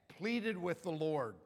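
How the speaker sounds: ordinary talk, nothing marked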